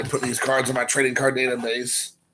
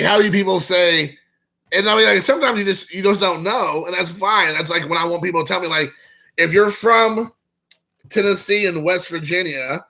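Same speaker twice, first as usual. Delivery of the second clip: ordinary talk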